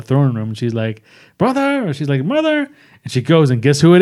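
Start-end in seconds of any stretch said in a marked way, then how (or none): 1.4-1.9 high-pitched mock calling; mimicked high searching call; imitated calling out. Imitating Shuri calling into throne room. 2.2-2.7 very high mock calling; mimicked high searching call; imitated calling out. Imitating Shuri calling into throne room.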